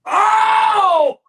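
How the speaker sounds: sudden high shout of excitement; excited shout. Real, not acted. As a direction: cry out in delight. Listener reacts to preaching.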